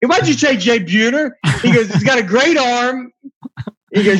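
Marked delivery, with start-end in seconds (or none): 0.1-1.3 higher, lightly acted indignant dad; mock complaint, pitched up a little; Frank Costanza. Fan quoting a dad confronting his son's boss, mock indignation. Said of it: "Frank Costanza". 1.9-3.1 higher, lightly acted indignant dad; mock complaint, pitched up a little; Frank Costanza. Fan quoting a dad confronting his son's boss, mock indignation. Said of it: "Frank Costanza".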